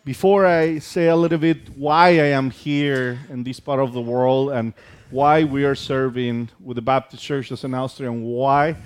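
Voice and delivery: normal talking voice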